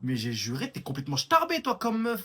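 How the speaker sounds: loud offended outburst. Acted. Insulted guy shouting at the woman interviewing him, loud outrage. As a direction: yell it in mock offence. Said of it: "reprends ta voix naturelle, parce qu'à part les décibels".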